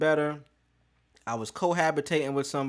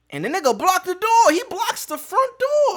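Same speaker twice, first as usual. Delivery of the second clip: much higher and louder, outraged disbelief; incredulous outburst; 7 dB louder than usual. Real, not acted. Telling friends how the guy blocked the door, shrill outrage. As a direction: squeal it like you can't believe he did that.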